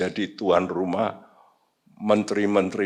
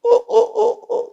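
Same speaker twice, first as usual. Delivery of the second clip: high, loud cock-crow hoots; imitated rooster crow; 6 dB louder than usual. Preacher showing when cocks crow, imitated crowing. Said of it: "ayam itu mulai keluruk".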